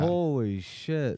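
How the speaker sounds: stretched-out stunned reaction; real amazement. Real, not acted. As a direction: draw it out, floored by the story. Listener amazed by the knockout.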